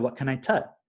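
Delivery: "tut" with a t where the k of cut belongs; child saying "tut" for "cut"; lightly imitated kid's "tut"; toddler's "tut". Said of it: "He said".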